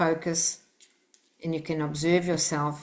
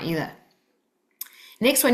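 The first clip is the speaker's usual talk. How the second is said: ordinary talk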